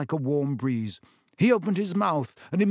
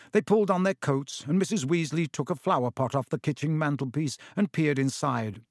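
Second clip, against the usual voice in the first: ordinary delivery, nothing marked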